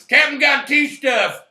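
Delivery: loud, slow, laughing movie quote; quoted movie line. Retold or imitated. Quoting his favorite line from Guardians, loud laughing shout. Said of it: "my favorite line of the first Guardians is".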